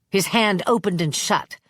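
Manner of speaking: ordinary delivery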